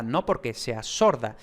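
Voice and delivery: ordinary delivery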